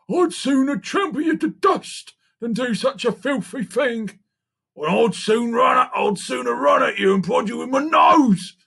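high-pitched storybook animal threat; indignant big beasts. A narrator's reading. Elephant and rhinoceros warning off monkey, indignant. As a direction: swell to a loud finish, voice held high.

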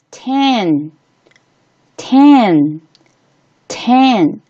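ten said slowly with an open eh; spaced, drawn-out ten for learners. Shown as a demonstration. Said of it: "在这里发短元音".

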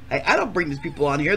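ordinary talk

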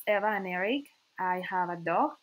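usual speaking voice

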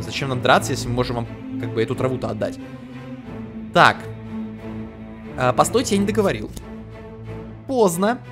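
ordinary talk, nothing marked

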